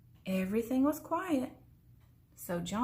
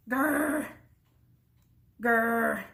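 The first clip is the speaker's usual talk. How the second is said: two short growls, louder; two bear growls; 3 dB louder than usual. A narrator's reading. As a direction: growl once for each bear.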